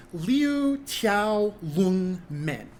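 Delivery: "Liu Tiao Lung Men" said very slowly, syllable by syllable, with English-speaker tones; foreigner speaking Mandarin. Shown as a demonstration. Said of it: "my Chinese pronunciation not great".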